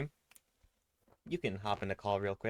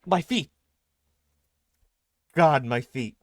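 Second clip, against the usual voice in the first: alarmed; 4 semitones higher, 11 dB louder, slower than usual. Acted. In-game reacting to feet.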